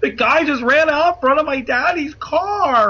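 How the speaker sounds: high, excited imitation of a small child; little kid voice. Four-year-old tells woman cop about daddy's car, high childlike excitement. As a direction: pipe up like a four-year-old telling on someone. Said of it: "Colby is just like".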